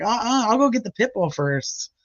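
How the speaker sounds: high-pitched playful refusal; comic refusal. Retold or imitated. Dog rescuer joking with squatters, high comic refusal. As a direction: balk at it in a high voice. Retold refusal, others cracking up.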